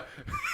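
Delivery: soft, high-pitched laugh; real quiet squeal of laughter. Real, not acted. Laughs at T-shirt joke.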